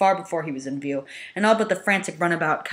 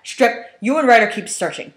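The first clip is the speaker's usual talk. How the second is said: louder, higher call over the shoulder; calling back; 6 semitones higher, 7 dB louder than usual. Acted. Motormaster calling orders back to Dragstrip. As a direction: shout the order back as he stomps off. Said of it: "calling back".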